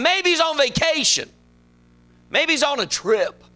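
high, mock-helpful taunt; Elijah. Elijah teasing Baal's prophets about their absent god, mocking. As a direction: needle them with high-pitched suggestions. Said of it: "he starts mocking".

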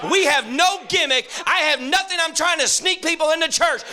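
fiery preacher rallying the church; preaching at full force. Real, not acted. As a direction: preach it out to the back pew.

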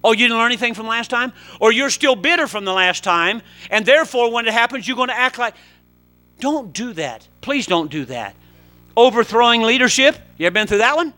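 loud, high preaching; impassioned preaching. Real, not acted. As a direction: preach it with rising fire. Preaching continues.

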